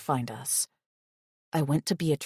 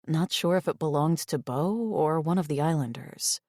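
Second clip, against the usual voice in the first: ordinary delivery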